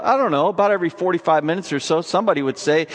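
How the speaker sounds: ordinary delivery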